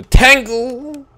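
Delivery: crowing wordplay; gloating taunt. Real, not acted. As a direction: belt out the pun word. Taunting pun on Mangle's name.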